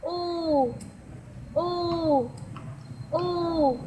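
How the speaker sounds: "ooh" held as a pure long oo; vowel sound demo. Shown as a demonstration. Teacher sounds out the vowel for the class.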